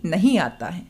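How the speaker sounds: "नहीं आता है" as one joined phrase; no pause after नहीं. Shown as a demonstration. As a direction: keep "नहीं" linked to "आता है". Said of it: "कोमा ना लगाने से".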